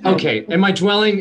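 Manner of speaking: ordinary delivery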